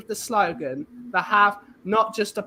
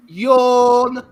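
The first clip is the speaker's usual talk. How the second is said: bored mock yawn; 2 semitones higher, 9 dB louder than usual. Mocking boredom.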